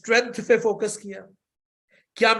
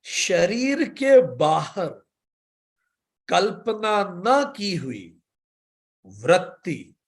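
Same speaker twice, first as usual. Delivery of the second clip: slow explanation; much slower than usual. Shown as a demonstration. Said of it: "explains the sutra phrase by phrase".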